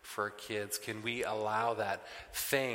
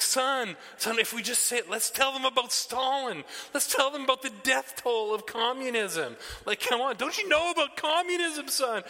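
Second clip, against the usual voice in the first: lecturing dad; 14 semitones higher, 6 dB louder than usual. Retold or imitated. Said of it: "And I'm like".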